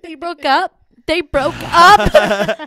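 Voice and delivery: loud, high wail building to a drawn-out cry; hammed-up lament, stretching the repeat; mock heartbreak. Woman laments the birds' breakup, mock wailing. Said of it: "it was so sad".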